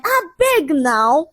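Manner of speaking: high, drawn-out plea; slow, high-pitched exasperation; exasperated hiss. A narrator's reading. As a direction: drag it out in irritation. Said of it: "He hissed again like a snake".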